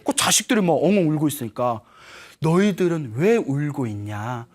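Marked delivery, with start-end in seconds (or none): 2.4-4.5 lightly acted father, slow and lower; a hint of a solemn old father; dying father. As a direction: ask slowly, in a lowered voice. Father waking, questions his children.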